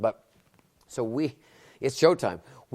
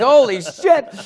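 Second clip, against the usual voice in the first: panicked outburst; 14 semitones higher, 17 dB louder, much slower than usual. Retold or imitated. Panicking as walk-on music starts.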